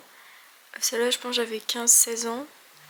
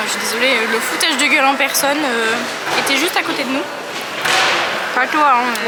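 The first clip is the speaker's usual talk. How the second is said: indignant; 10 dB louder than usual. Real, not acted. Complaining about mocking examiner.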